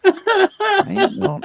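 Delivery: cracking up mid-sentence, high and loud; words lost in real laughter; laughing. Real, not acted. Comic laughing at his own joke, real laughter. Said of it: "breaks into laughter".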